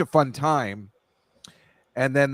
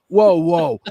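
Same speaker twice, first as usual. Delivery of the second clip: loud, higher-pitched mock objection; retold protest; 6 semitones higher, 9 dB louder than usual. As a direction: cut in loudly, reenacting your protest. Retelling his protest to friend.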